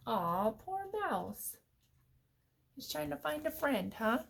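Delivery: commiserate gently, drawing out the words; tender aside to little listeners; sympathetic. Real, not acted.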